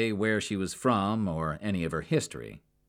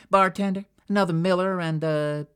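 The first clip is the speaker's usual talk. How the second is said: much higher, louder call across the bar; 12 semitones higher, 8 dB louder than usual. Acted. Jackie calling the bartender across the bar. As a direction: hail the bartender.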